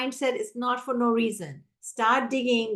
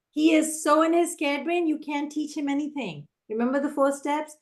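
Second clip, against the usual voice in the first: ordinary talk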